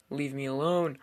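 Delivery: ordinary delivery, nothing marked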